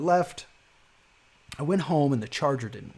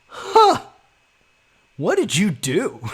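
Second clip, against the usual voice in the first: much higher and louder, astonished huh; shocked clerk; 7 semitones higher, 9 dB louder, slower than usual. Retold or imitated. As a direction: retell the clerk's alarmed double take. New clerk sees below-cost price.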